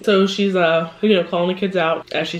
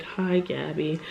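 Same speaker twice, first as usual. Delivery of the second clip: defeated; 3 semitones lower, 8 dB quieter, much slower than usual. Retold or imitated. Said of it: "not even his normal".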